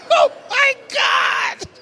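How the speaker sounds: laughing exclamation. Real, not acted. Laughing exclamation after mom's joke.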